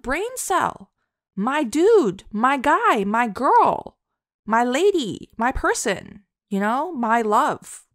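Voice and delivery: high, loud excited gushing; over-the-top affectionate shout-out. Retold or imitated. Gushing thanks to viewers.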